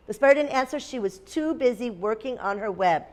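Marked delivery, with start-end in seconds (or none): none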